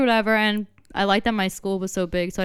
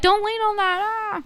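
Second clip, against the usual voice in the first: mock alarm; 10 semitones higher than usual. Quoting movie characters' warning.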